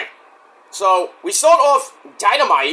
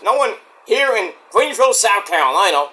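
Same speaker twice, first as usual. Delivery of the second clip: usual speaking voice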